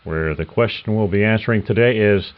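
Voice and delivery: punchy, loud podcast-teaser read; announcer open. Acted. Host teasing the episode to listeners, loud announcer read. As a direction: sell the episode like a promo.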